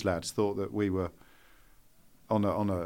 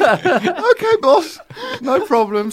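high, loud, laughing eager agreement; a junior falling over himself to please; eager young yes-man; 12 dB louder than usual. Retold or imitated. Junior reporter to his boss, mock-eager and laughing, high and loud. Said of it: "I'd have dressed up as a chicken".

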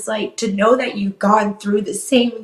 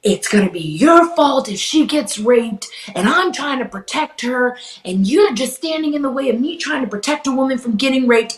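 retold angry outburst; 5 semitones higher than usual. Imitating her boyfriend losing it on her, a mild mock yell. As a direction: replay his blow-up with some heat, not a full yell. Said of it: "he lost it on me saying".

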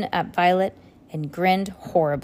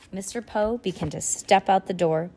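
usual speaking voice

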